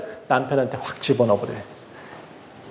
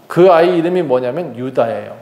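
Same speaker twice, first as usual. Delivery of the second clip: usual speaking voice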